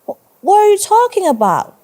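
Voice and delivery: high, loud bewildered question; confused. A narrator's reading. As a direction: stammer into a startled, high-pitched question. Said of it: "looking confused".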